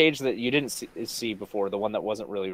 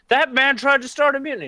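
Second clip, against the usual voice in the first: high, loud, indignant outburst; angry captain; 7 dB louder than usual. Acted. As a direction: accuse the traitor sharply. Captain angrily reports mutiny.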